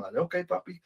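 put-on high, soft kid's reply; mimicking son. Father voicing his son agreeing, high and soft. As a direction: give in like a meek little boy. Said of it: "ya se queda como".

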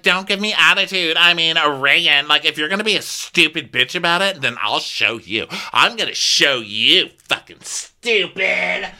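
high, loud hammed-up tirade; mock angry rant. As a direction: imitate a guest spitefully threatening, last word stretched. Acted ranting guest threatening crew.

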